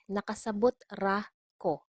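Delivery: "Nakasabot ra ko" with pauses round "ra"; word-by-word model. Shown as a demonstration. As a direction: set "ra" and "ko" apart, clearly. Teacher separates words, then repeats.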